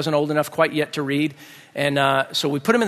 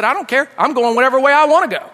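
high, raised acted comeback; hammed-up defiance, pitched up and loud; defiant retort; 8 semitones higher, 5 dB louder than usual. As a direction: shout back stubbornly. Dad defies the furious kids.

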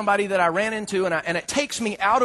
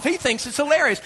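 ordinary talk, nothing marked

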